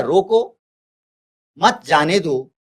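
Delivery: "रोको", a full pause, then "मत जाने दो"; pause after रोको. Shown as a demonstration. Teacher reads out the second example sentence, pausing after "रोको". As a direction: break right after "रोको", then run "मत जाने दो" together. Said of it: "अल्प विराम का हमने प्रयोग किया है".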